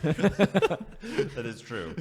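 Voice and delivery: laughing. Real, not acted. Laughing at the joke.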